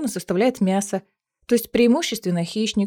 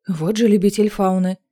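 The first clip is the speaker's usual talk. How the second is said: usual speaking voice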